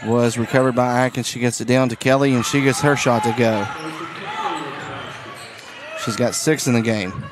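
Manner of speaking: brisk courtside basketball call; play-by-play. Acted. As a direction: call the game as it happens.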